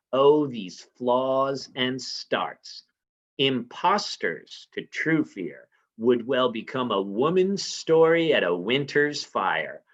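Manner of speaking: drawn-out, deliberate contempt; a wife scornfully mocking her husband's fear, very slow; slow scornful reading. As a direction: stretch each word like a slow rebuke. Dramatic reading of Lady Macbeth scolding.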